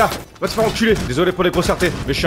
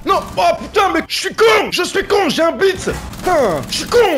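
angry shout; 11 semitones higher, 4 dB louder than usual. Real, not acted. Raging at own game mistake.

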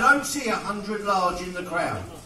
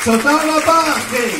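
put-on a touch of Israeli accent, loud and high; 7 semitones higher, 10 dB louder than usual. Auctioneer thanks his Israeli friend in an Israeli accent. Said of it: "you want me to talk like an Israeli?".

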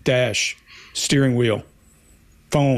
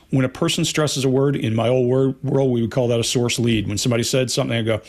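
ordinary talk

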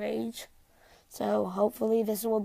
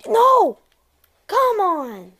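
loud, high cry of frustration; frustrated shout; 10 semitones higher, 13 dB louder, much slower than usual. Real, not acted. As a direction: yell out in annoyance. Killed suddenly in game.

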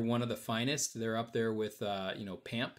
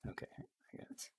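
whisper; 19 dB quieter than usual. Real, not acted. Aside to someone off-mic.

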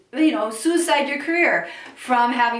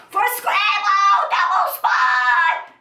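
scratchy; 8 semitones higher, 5 dB louder, slower than usual. Shown as a demonstration. Fry demo spills into talk.

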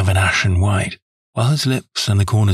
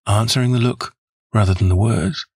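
ordinary delivery

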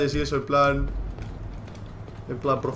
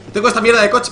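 indignant; 7 semitones higher, 8 dB louder than usual. Real, not acted. Car replaced by bad one.

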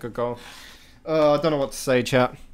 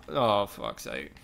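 ordinary delivery

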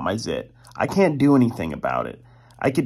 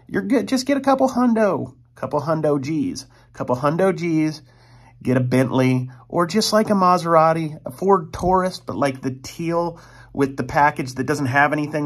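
normal talking voice

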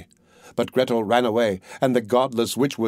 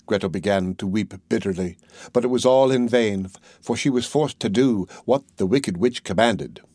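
usual speaking voice

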